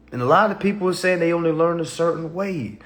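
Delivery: usual speaking voice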